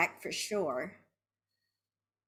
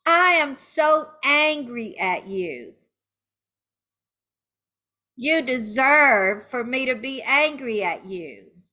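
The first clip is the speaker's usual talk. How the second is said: loud, higher, emphatic anger; angry venting; 5 semitones higher, 6 dB louder than usual. Shown as a demonstration. Betrayed partner to the cheater, loudly angry. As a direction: throw the anger straight at them. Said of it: "saying angry words now".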